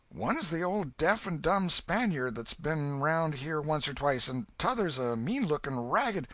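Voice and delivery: put-on a frightened boy begging for secrecy; scared boy Huck. Huck begs the old man to keep his name secret, high and scared.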